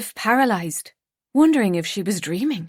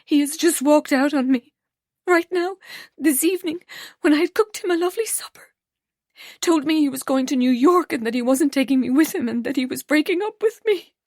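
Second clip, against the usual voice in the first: higher, rushing distressed voice; upset, near tears; 6 semitones higher, faster than usual. A narrator's reading. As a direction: spill it out in a rush. Rose tearfully tells grandmother.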